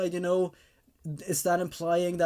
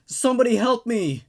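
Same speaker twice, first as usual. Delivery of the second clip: much higher, much louder desperate scream; screaming for help; 6 semitones higher, 8 dB louder than usual. Acted. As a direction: cry out for help with nobody near. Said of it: "scream for help".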